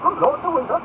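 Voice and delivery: high, loud questioning repeat; surprised echo. Acted. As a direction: echo the name in a lordly high voice. Duke repeats name as question.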